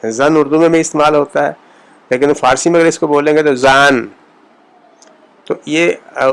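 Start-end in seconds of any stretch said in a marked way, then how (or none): 3.6-4.1 زان with a wide open aa; open Persian a. Shown as a demonstration. Teacher giving Persian version of Urdu زن. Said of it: "زبر کا تلفظ ذرا کھل کر".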